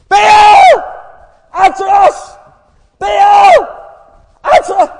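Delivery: high, full-force yells. Acted. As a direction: bellow again and again to be heard. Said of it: "they all get a wee bit louder".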